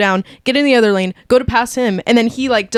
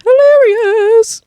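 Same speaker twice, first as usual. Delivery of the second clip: high, loud and slow delight; excited; 12 semitones higher, 4 dB louder, slower than usual. Retold or imitated. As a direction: gush over a funny movie. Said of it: "I was like".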